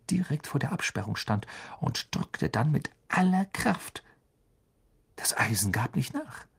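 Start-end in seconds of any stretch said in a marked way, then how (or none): none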